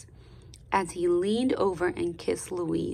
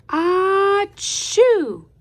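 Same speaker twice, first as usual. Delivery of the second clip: loud high acted sneeze; big sneeze; 11 semitones higher, 9 dB louder than usual. Storyteller acting out Louise's sneeze, loud. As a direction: throw out a huge sneeze.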